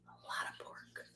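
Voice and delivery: barely audible whispered echo. Real, not acted. Host repeating the punchline to listeners, whispered. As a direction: repeat it under your breath, unvoiced.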